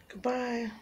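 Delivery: soft whispered goodbye to the cat; gentle whispered farewell to the cat. Real, not acted. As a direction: breathe the goodbye softly.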